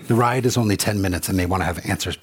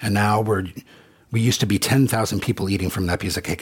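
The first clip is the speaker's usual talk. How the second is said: normal talking voice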